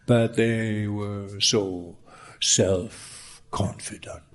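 slow, low, half-whispered irony; slow ironic hush. Acted. Preacher mocks disciples' confidence.

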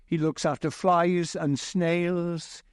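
usual speaking voice

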